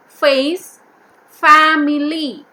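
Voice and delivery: "family" drawn out slowly, vowel as in "fam"; stretched vowel demo. Shown as a demonstration. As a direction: spell the vowel out in "Face, family". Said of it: "When I say family, A is pronounced as A sound".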